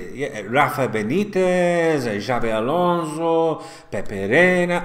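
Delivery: slow names in a put-on Spanish accent; mock Spanish accent. Shown as a demonstration. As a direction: roll the footballers' names like a Spaniard, slowly. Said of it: "with the lingo of the Spanish accent".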